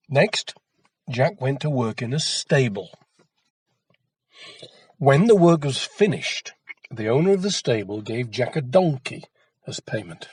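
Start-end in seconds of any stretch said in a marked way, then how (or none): none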